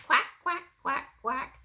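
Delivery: high, loud duck quacking; Mama Duck voice. Acted. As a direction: call out like a mother duck. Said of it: "Mama duck said".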